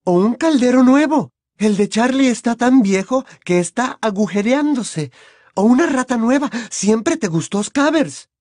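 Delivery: high, loud, gushing mother voice; madre entusiasmada. Acted. Narrator plays Mrs Weasley. (voz de madre entusiasmada, muy aguda y fuerte)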